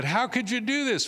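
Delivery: high, alarmed outcry; frightened sailors rounding on Jonah, high and alarmed; shocked sailors. Acted. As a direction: cry out in disbelief.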